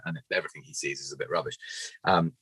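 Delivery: usual speaking voice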